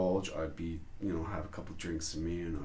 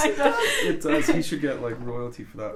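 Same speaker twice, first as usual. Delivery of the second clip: laughing through the words, louder; chuckling out a joke; 6 semitones higher, 11 dB louder than usual. Real, not acted. Laughing as he agrees with his friend about the Angry Birds look.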